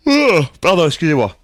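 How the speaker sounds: talking through a yawn; mid-yawn, mouth wide open. Real, not acted. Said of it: "Je baille, euh, à la bouche grande ouverte".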